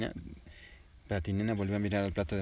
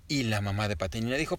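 plain reading voice

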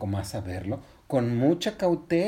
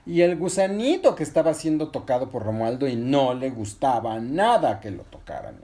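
ordinary delivery

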